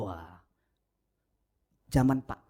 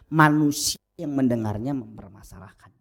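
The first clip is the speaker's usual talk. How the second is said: ordinary talk, nothing marked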